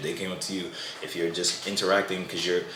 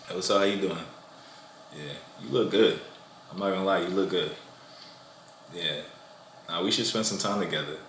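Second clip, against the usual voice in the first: nonchalant bad boy; 5 dB quieter than usual. Shown as a demonstration. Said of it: "the bad boy comes over with the nonchalant energy".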